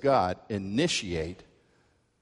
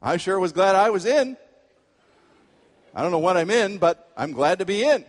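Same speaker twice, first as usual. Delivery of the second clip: pleased; 6 semitones higher, 6 dB louder than usual. Retold or imitated. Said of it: "And one man said".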